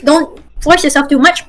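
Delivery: usual speaking voice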